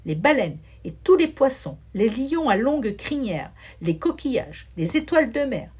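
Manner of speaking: ordinary delivery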